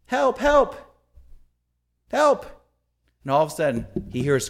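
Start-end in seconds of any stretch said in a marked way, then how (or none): 0.0-2.5 high, spaced-out cries; acted call for help. Trapped man calls out again.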